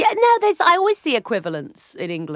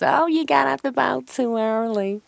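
lightly raised, teasing American friend; a hint of a teasing friend; friend's teasing quote; 6 semitones higher than usual.

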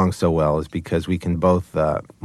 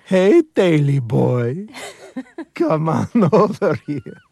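very high, slow Eastern European coaxing; dog's Eastern European voice; 9 semitones higher, slower than usual. Acted. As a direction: lure the tail over in a sweet, high Eastern European accent. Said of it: "Zabu's face is just sticking out".

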